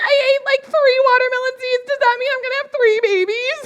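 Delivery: put-on high, half-laughing child's panic; a small kid scared of three babies; worried little kid. Little [name] asking the older kids about babies, high and worried.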